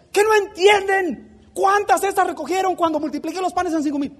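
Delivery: high, urgent scolding of the disciples; energetic rebuke. Pretend. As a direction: press them hard, why don't they get it. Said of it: "enérgicamente hablando con los discípulos". (Jesús reprendiendo con energía a sus discípulos)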